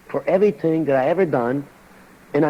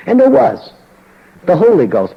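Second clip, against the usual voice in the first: loud exclamation; 6 semitones higher, 6 dB louder than usual. Real, not acted. Preacher's punchline to congregation.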